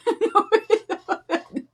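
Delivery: a sudden high laugh bursting out; high burst of laughter. Real, not acted. Laughing at her own joke to viewers, high burst of laughter. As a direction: crack up high and bright.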